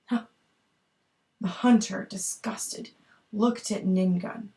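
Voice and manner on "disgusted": ordinary delivery, nothing marked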